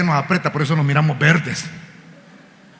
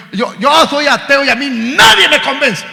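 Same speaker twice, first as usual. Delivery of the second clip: high-pitched defiant bragging; boastful atheist; 8 semitones higher, 5 dB louder than usual. Retold or imitated. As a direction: brag as if nobody could sway you. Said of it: "algunos que se glorían, ... alardean".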